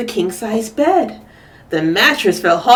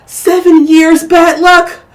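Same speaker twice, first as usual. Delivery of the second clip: dismayed; 8 semitones higher, 9 dB louder than usual. Acted. Goldie dismayed after crash.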